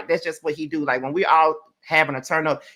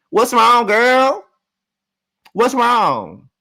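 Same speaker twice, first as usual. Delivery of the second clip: loud call-out; 6 semitones higher, 6 dB louder, much slower than usual. Acted. Calling out to cast member.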